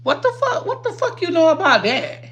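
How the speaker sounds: high-pitched incredulous what the fuck; shocked outrage. Retold or imitated. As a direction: shriek it in disbelief. Reliving outrage over boyfriend.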